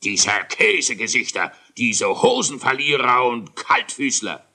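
loud angry royal insults; blustering tantrum; raging king. Acted. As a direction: spit out the insults like a furious king. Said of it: "Der König wütete".

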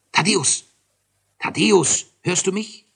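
high, loud urgent call. A narrator's reading. Calling sleeping friend from bed.

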